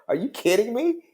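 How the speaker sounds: high, stunned disbelief rising at the end; incredulous. Shown as a demonstration. Showing listeners how he said it, high incredulous. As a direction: repeat it exactly, high and amazed. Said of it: "I said, just like that".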